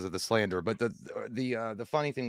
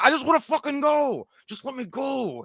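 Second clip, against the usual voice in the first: desperate husband; 13 semitones higher than usual. Retold or imitated. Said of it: "he's like".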